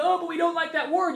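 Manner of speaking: high mock whine of complainers. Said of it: "they go".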